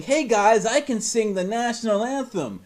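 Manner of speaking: high, loud eager announcement; mock eager kid. Picturing the teen boasting to classmates. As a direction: puff up like a proud little kid. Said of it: "when he's fifteen trying to sing".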